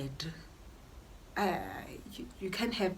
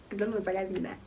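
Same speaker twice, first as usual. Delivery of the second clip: usual speaking voice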